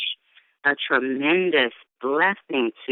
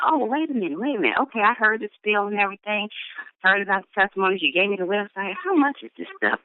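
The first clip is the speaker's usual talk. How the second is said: eager stranger; 6 semitones higher, faster than usual. Retold or imitated. Voicing the stranger's eager question.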